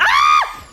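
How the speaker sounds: sudden high shriek; sharp high startled yelp; startled scream. Real, not acted. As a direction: yelp in fright at a surprise. Sudden scare in firefight.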